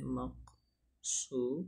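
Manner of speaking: مقصورہ drawn out syllable by syllable; slow dictation. Shown as a demonstration. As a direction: say مقصورہ slowly while writing it. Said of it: "اسے لکھتے ہیں".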